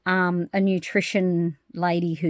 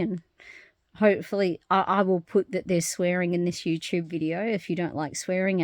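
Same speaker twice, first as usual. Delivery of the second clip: normal talking voice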